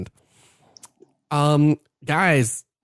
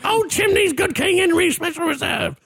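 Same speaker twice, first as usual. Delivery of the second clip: mocking very high old-woman falsetto; exaggerated comic falsetto, far above usual pitch; Monty Python old woman. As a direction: mimic a friend's English biddy voice. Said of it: "That's what that sounded like".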